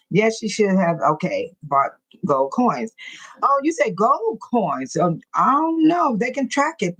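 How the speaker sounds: usual speaking voice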